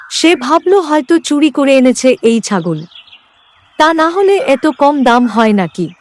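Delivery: plain reading voice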